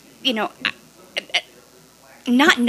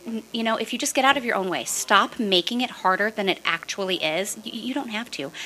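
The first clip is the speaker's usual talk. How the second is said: normal talking voice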